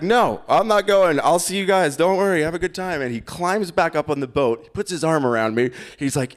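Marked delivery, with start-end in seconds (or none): 0.0-3.0 high-pitched, louder brush-off; retold firm refusal. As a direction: act out begging off the dive in a raised voice.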